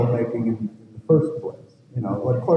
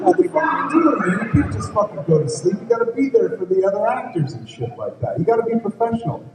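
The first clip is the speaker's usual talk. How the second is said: high-pitched mock telling-off; mock scolding; 9 semitones higher than usual. Director scolds the sleepy actor, high mock anger. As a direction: scold him, half laughing.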